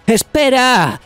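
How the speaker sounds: grito agudo y alto para detenerlo; llamada a gritos. A narrator's reading. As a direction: gritarle a alguien que sale corriendo. Los demás llaman a Luffy. (los compañeros llaman a gritos a Luffy)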